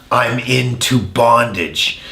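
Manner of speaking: ordinary delivery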